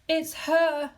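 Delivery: high, loud terrified groan. A narrator's reading. Wife groaning at her husband, high and loud. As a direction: moan it out in dread. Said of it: "she groaned again".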